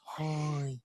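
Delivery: lightly voiced cake character; a hint of a silly creature voice. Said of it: "That's how he sounds".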